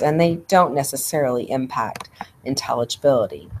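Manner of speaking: usual speaking voice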